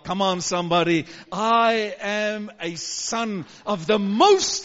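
loud, slow, rousing declaration; full-force preaching. Acted. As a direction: proclaim it to the congregation.

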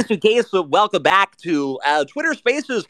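smooth radio host intro; radio countdown announcer; Casey Kasem. Acted. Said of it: "Casey Kasem on the radio".

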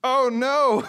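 high, loud alarmed cry; exclaiming. Retold or imitated. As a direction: yelp it in sudden dismay.

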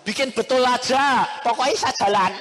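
loud insistent demand; insisting. Retold or imitated. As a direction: push the demand on the mechanic. Insisting to the mechanic.

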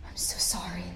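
whispered, soft apology; sorrowful whisper. Acted. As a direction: breathe it out in grief. Officer aside over ensign.

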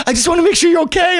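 very high, quick mom voice; high-pitched worried-mom imitation. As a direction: imitate a fussing mother, high and hurried. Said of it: "She's like".

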